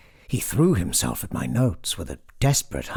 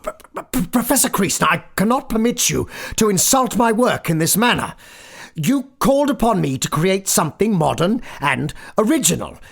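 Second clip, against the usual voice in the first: higher voice, stammering then defiant; indignant younger man; 11 semitones higher, faster than usual. Acted. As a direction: stand up to the professor, voice shaking up high. Narrator voices stammering indignant composer.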